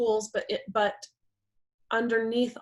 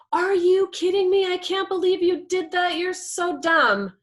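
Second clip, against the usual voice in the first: loud, high outburst of outrage; angry partner; 9 semitones higher, 6 dB louder than usual. Acted. Partner reacting to a smashed car, angry and loud. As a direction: lay into them, louder and higher, drawing out the insult. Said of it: "That person can come in and be like".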